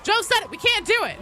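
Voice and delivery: loud, high-pitched cry of dismay; mock exasperated shout. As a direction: yell it like a thwarted developer, hammed-up. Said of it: "and then they'll be like".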